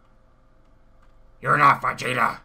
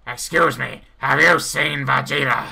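usual speaking voice

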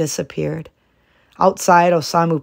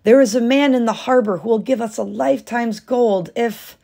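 eager, higher voice; excited husband; 6 semitones higher, 4 dB louder than usual. A narrator's reading. Husband telling wife of the gold, eager. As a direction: rush the news out eagerly.

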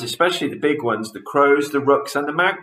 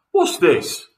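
higher, louder startled question; shocked gasp; 8 semitones higher, 5 dB louder than usual. A narrator's reading. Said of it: "gasped Mister Twit".

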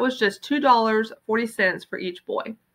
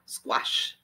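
breathy whisper, relishing it; whispered squash; 9 dB quieter than usual. Acted. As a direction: hush it with relish. Paul relishing flattened pennies.

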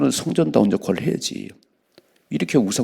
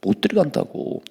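higher, whining complaint; spies' bad report; 9 semitones higher than usual. Preacher mimicking the spies whining they can't enter, raised pitch. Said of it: "악평을 하는지".

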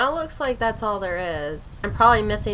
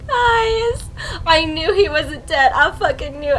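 much higher, louder, teary; tearful joy; 7 semitones higher, 7 dB louder than usual. Real, not acted. Streamer telling viewers she knew he lived, teary and loud. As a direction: cry it out to the viewers. Crying over character surviving.

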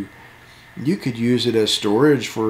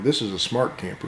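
country accent; 6 dB quieter than usual. Real, not acted. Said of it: "like how you say that in your best country accent".

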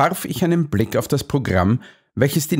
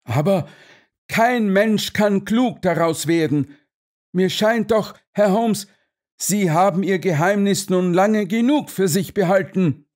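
high, exasperated colonel; agitated demand; 9 semitones higher than usual. A narrator's reading. Colonel demands Holmes's secret, agitated. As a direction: press for an answer in a raised voice.

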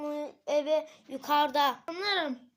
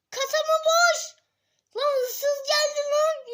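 much higher, louder shocked yelp; shocked character; 11 semitones higher, 6 dB louder than usual. Acted. Friend hearing the till is empty, shrieking in shock. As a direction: squeal in disbelief.

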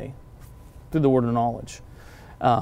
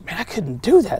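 emotional amazement; 7 semitones higher, 4 dB louder than usual. Retold or imitated. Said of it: "having this emotional response".

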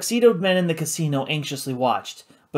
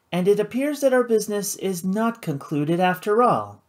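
a slightly higher, refined lady; smooth and controlled, a touch up; lightly voiced poised hostess; 7 semitones higher than usual. Karina explaining her club, cool and poised.

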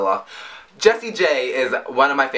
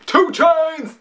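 [name] impression; 14 semitones higher than usual. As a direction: bark his name like the rapper's hype tag. Repeats rapper ad-lib.

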